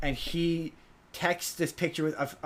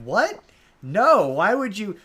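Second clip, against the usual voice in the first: incredulous disbelief; 6 semitones higher, 6 dB louder, slower than usual. Retold or imitated. Viewer reacting to the movie.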